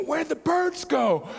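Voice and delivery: high, drawn-out exasperated cry; exasperated, comically overwrought question; mock exasperation. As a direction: ask it as a hammed-up, high-pitched plea. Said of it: "becoming a little more exasperated".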